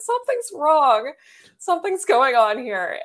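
alarmed. Retold or imitated. Retold alarm at no sales.

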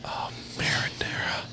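very soft, whispered savoring; mock aroused whisper. Aroused caller hearing the sauce, very soft mock whisper. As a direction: whisper it like a secret thrill.

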